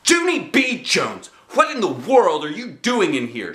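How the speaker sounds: outraged mother; shouted. A narrator's reading. Mother shouting at the nailed door. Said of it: "she shouted".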